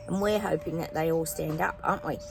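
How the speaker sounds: normal talking voice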